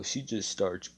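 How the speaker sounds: usual speaking voice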